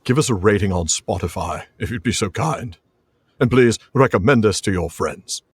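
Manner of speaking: dubious mock-British accent; vague, badly faked British accent; put-on British accent. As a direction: attempt a polite British plug. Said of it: "not my voice, so it's some sort of accent".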